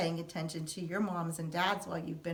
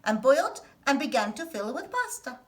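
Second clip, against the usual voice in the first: put-on lively, expressive read-aloud for kids; storybook reading; 5 semitones higher, 8 dB louder than usual. A narrator's reading. Host reading a picture book to children, animated storytelling. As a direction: tell it like a bedtime picture book, big and animated.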